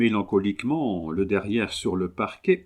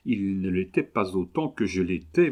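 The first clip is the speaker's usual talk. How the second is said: usual speaking voice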